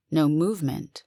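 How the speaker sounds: plain reading voice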